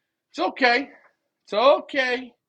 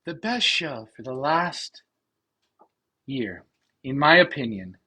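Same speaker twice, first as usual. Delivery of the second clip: voice of Sherry; 5 semitones lower than usual. Retold or imitated. Reading wife Sherry's comment aloud to viewers, lightly mimicked. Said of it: "I gotta put a voice of Sherry on".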